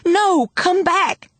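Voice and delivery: very high, loud cry; desperate holler. A narrator's reading. Girl hollering after the lady with her baby, frantic. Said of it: "I hollered".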